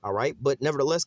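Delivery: ordinary talk, nothing marked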